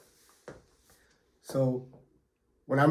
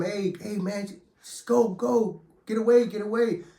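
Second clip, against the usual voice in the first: higher, urgent retold yelling; yelling to get away; 7 semitones higher than usual. Inmates yell at stabber to get away, higher urgent call. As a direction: call him off, pitched up and pressing. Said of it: "is yelling at him".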